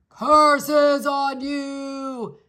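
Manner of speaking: slow, drawn-out loud roar of a curse; yelling curse. A narrator's reading. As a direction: bellow it back like a furious giant. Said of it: "the Cyclops yelled back".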